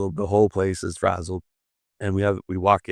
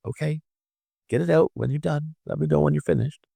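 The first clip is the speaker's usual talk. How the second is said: put-on higher-pitched talk to a crying child; patient grandpa; 8 semitones higher than usual. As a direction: let a small child cry it out, voice lifted. Grandfather answering screaming, crying grandkids.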